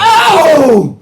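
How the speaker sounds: loud, high gasp of surprise; surprised shout. Real, not acted. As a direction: shout in shock. Reacting to roll result.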